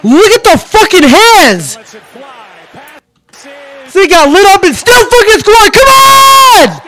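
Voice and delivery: high, loud cheering shout, slow and drawn out; excited yelling. Real, not acted. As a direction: yell at the screen as your team scores. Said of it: "Stop yelling".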